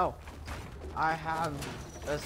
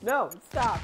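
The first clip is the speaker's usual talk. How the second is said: ordinary talk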